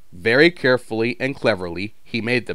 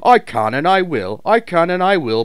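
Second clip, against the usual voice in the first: loud, firm vow; determined puffing; 8 semitones higher, 7 dB louder than usual. A narrator's reading. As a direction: push through with grit. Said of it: "puffed James".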